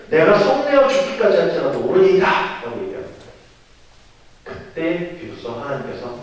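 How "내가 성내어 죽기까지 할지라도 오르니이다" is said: loud, higher-pitched scripture retort; acted defiant outburst; defiant Jonah. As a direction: declare it angrily to God. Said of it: "요나가 하시기를".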